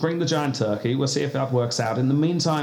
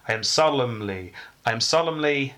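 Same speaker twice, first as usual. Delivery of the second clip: normal talking voice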